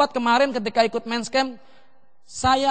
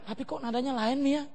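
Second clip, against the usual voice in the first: imitated child; 9 dB quieter than usual. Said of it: "Bukan yang doa mereka".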